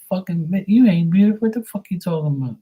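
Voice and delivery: incredulous retort, acted out; retold indignant rebuke. Retelling his blunt rebuke to people.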